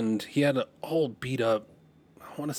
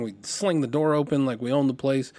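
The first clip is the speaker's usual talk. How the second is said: usual speaking voice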